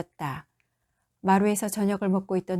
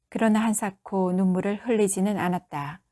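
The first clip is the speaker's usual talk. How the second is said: usual speaking voice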